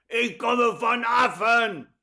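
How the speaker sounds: high, loud, slow mocking yell; heckler's shout. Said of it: "schrie dann einer". (höhnischer Zwischenruf, laut und hoch)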